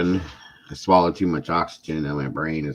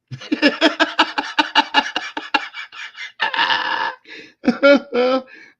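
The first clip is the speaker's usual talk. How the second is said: very long, loud, high laughing fit; uncontrollable laughter; 7 dB louder than usual. Real, not acted. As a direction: completely lose it laughing. Imagining the absurd TV show.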